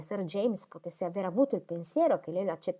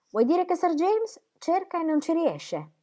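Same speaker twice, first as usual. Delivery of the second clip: higher, slower voice of the younger sister; a sister probing gently, pitched up; Celia's voice; 11 semitones higher, 4 dB louder than usual. A narrator's reading. Celia questioning Dorothea about Sir James, pitched up.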